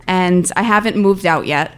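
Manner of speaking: ordinary talk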